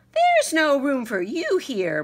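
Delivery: a small mouse laying down the law; stern squeak; bossy mouse. A narrator's reading. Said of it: "Mortimer said".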